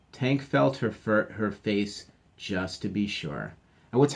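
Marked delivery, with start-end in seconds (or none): none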